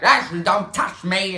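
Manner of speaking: loud, high, drawn-out mock snarl of protest; aggressive outburst. Sleepwalking mom resists being seated, aggressive yell. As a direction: lash out loudly, imitating an aggressive sleepwalker. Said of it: "gets all aggressive".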